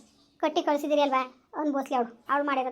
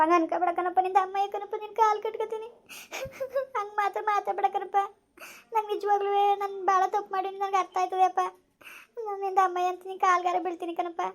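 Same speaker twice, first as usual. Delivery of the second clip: a daughter sobbing as she begs forgiveness; tearful pleading; 5 semitones higher than usual. Acted. Daughter begs father, crying.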